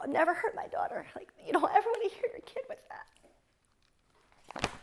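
real tears in a breaking whisper; choked up. Real, not acted. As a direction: voice gives out as the memory hits.

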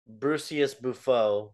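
slow, stretched mock French name; lightly mock the French announcer. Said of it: "we've never heard it in French".

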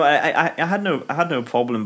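ordinary talk

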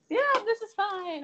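bright, high, falsely cheery; fake cheerful. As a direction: chirp it like all is fine.

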